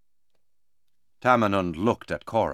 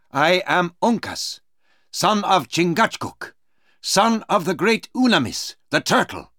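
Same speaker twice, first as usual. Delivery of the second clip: higher, ringing character voice for Uncas; proud young Mohican warrior; 8 semitones higher than usual. Acted. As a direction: declare your lineage proudly, pitched up.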